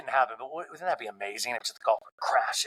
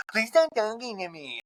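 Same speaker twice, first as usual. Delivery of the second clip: high, garbled mimicry of a rival; mocking; 6 semitones higher than usual. Housewife mimicking her rival's complaint, high mocking voice. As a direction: parrot it back in a silly high voice. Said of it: "Monica's mocking Lisa".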